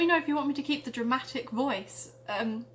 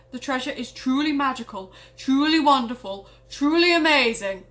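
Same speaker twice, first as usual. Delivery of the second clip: grand proclamation; 7 dB louder than usual. Acted. Fridge proclaims magical treasure.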